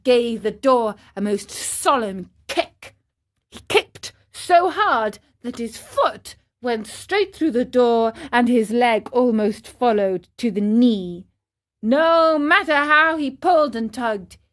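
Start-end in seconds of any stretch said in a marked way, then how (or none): none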